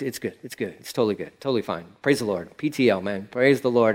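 low, hushed, brushing it off; fake fine. Mocking a churchgoer faking he's fine, low and hushed. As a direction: pretend everything's fine. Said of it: "the church game where he's like".

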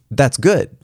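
GOOD pitched high and firm in "That's good"; emphatic. A narrator's reading. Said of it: "I said emphatically".

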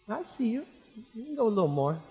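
high, light, unhurried sing-out; Jesus calmly watching. Acted. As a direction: tease it out lightly, in no rush to rescue. Jesus watching Peter sink, unhurried.

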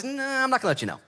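high-pitched, drawn-in mock refusal; playful refusal. The Lord answers the preacher's wish to know, playfully high. Acting the Lord's teasing refusal.

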